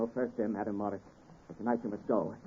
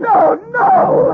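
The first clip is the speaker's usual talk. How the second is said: very high, loud cry of denial; anguished scream; 12 dB louder than usual. Acted. Victor hearing the housekeeper is dead, anguished scream. Learns Madam Morris is dead.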